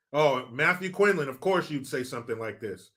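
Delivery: ordinary talk, nothing marked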